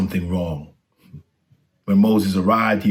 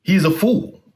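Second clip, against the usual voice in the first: high, louder teasing monk voice; sly monk; 11 semitones higher, 4 dB louder than usual. Acted. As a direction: Moses mocking himself in a higher voice. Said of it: "the monk said".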